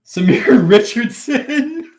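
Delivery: high, loud, drawn out through laughter; cracking up mid-name; laughing. Real, not acted.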